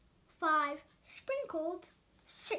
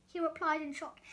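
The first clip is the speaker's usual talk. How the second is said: ordinary delivery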